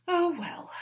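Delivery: high loud offhand lady; Mary dismissive. A narrator's reading. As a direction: wave it off.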